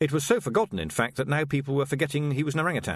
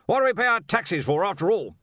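loud, high, blustering archchancellor; blustery old wizard; 9 semitones higher, 5 dB louder than usual. Acted. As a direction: demand it like a bluff old master.

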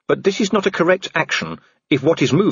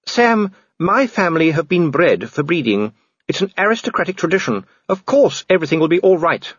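high-pitched aristocratic wife; higher voice, confidently brushing off her husband's worry; reassuring wife; 7 semitones higher than usual. Acted.

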